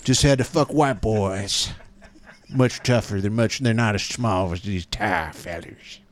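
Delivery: put-on old Eastwood grumble. Eastwood riff continues.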